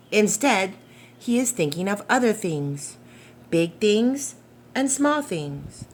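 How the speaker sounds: gentle storybook narration, unhurried; reading to children. Acted. Reading The Paper Boy aloud to young listeners, gentle storytelling. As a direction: read it like a bedtime picture book. Reads picture book aloud.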